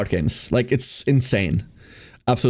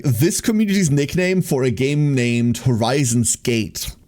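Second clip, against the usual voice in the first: normal talking voice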